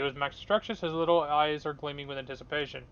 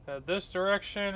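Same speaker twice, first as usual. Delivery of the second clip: ordinary talk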